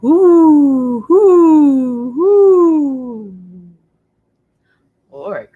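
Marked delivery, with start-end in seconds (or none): 0.0-3.3 high, louder hooting owl; big owl hooting in the night; great horned owl hoot. Acted. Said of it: "He could have been going".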